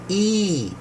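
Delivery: "E" held long and high; long E sound. Shown as a demonstration. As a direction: sustain a high, clear "E". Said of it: "and long E".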